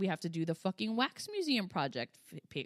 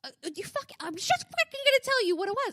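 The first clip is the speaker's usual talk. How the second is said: high-pitched, sputtering mock exasperation; exasperated; 11 semitones higher, 3 dB louder, faster than usual. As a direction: sputter as if at your wits' end. Acting out exasperated thoughts at son.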